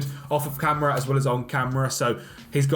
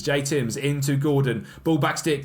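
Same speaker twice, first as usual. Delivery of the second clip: ordinary talk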